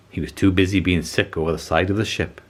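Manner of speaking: usual speaking voice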